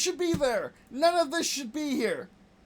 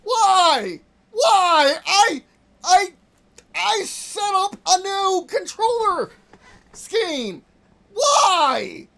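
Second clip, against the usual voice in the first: loud, raised, pleading outburst; exasperated yelling; 7 semitones higher, 7 dB louder, much slower than usual. Real, not acted. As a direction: yell in helpless frustration. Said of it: "Sorry that I'm yelling".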